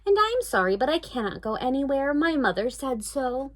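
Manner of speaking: put-on high, polite little girl voice, slowing at the end. Dory politely refusing the witch in a high girl voice. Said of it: "said Dory very politely".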